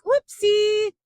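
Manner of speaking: high, loud mock careless whoopsie; careless driver. Driver who damaged your car, high flippant mock whoopsie. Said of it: "they're like".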